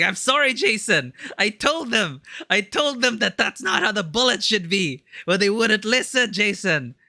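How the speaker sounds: high, pleading imitation of a distraught friend; mock-anguished apology. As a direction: plead in a hammed-up high voice, as if confessing a failure. Acts out classmate's mock-anguished apology.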